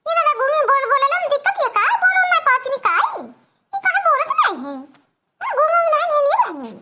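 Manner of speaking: high, loud put-on voice of a fussing mother-in-law; lifted comic village-aunt voice; coaxing mother-in-law. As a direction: call out to the bride, high and nagging. Mother-in-law coaxes silent bride.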